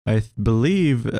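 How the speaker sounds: ordinary talk, nothing marked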